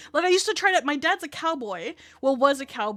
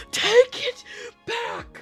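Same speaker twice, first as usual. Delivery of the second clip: mock furious demand; retold angry yell; 7 semitones higher, much slower than usual. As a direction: yell it at her like a furious teen. Girl yelling during a fight.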